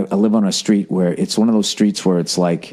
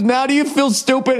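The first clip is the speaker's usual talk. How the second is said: high, sneering mock of the driver; smug taunt; 14 semitones higher than usual.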